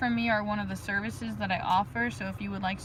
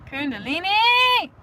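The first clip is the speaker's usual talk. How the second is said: high, loud, slower rapturous shout; drawn-out blissful exclamation of the word; ecstatic cry; 8 semitones higher, 7 dB louder, slower than usual. Shown as a demonstration. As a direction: call it out in spiritual ecstasy. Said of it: "So ecstatic".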